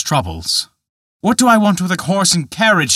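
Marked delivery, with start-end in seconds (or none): 1.2-2.9 raised, louder, bitter outburst; angry cry. A narrator's reading. Peter blames the glass man, shouting in anger. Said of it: "he cried".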